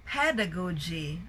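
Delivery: "pedagogy" with PED stressed, then falling; first-syllable stress. Shown as a demonstration. Teacher modelling the word, "pedagogy" stressed on PED. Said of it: "it's not pedagogy".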